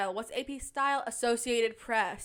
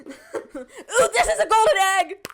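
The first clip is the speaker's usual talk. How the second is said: excited squeal; 10 semitones higher, 16 dB louder than usual. Real, not acted. Finding golden egg.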